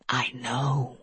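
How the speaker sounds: unvoiced, close menacing whisper. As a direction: breathe it into his ear. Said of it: "he whispered".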